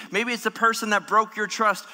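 normal talking voice

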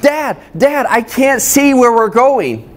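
put-on high, panicky child voice, slowing at the end; a frightened kid calling from the back seat; scared little boy. Son in the dark car calling to dad, high frightened child voice. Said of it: "he started freaking out".

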